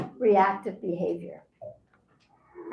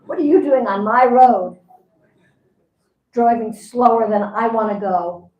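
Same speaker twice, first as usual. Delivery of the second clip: mock road-rage yelling, much louder; 8 dB louder than usual. Push everybody out of my way.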